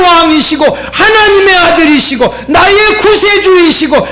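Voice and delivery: impassioned sermon climax; full-force preaching. Real, not acted. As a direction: urge the congregation fervently. Sermon climax and call to prayer.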